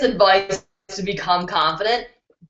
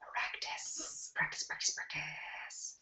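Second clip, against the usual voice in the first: breathy repeated whisper; soft emphatic whisper; 5 semitones lower, 24 dB quieter, slower than usual. Real, not acted. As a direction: whisper the word over and over.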